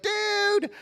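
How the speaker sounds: very high, drawn-out falsetto boast; hammed-up thrilled younger-brother voice; elated little brother. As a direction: sing it out slowly in a giddy squeal. Brother announces his news, thrilled.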